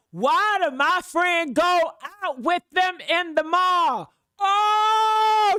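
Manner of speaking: loud, high, drawn-out wail; outraged disbelief. Retold or imitated. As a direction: shout it as if betrayed. Reliving shock at friend leaving.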